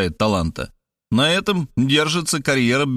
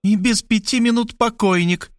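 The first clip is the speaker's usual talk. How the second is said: higher, mock-pitying jab; insulting pity; 10 semitones higher than usual. A narrator's reading. Markhaleva taunting Robert, insulting pity in a higher voice. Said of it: "с оскорбительной жалостью".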